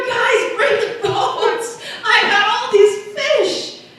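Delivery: high, loud excited shout across the water; yelling to shore. Pretend. Fisherman shouting to friends on shore for boats, loud and excited. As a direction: call out to the shore, thrilled with the catch. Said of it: "you're yelling back to shore".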